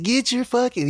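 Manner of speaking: high barking drill sergeant; drill sergeant yell. Retold or imitated. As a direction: bark it like a Marine drill instructor. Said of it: "He's like".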